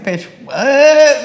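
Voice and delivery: loud, high disbelieving outburst; shocked. Retold or imitated. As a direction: blurt it out in total disbelief. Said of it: "and I was like".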